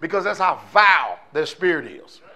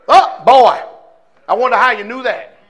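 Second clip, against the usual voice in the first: sarcastic; 4 semitones higher, 7 dB louder than usual. Retold or imitated. Sarcastic reply to birth check.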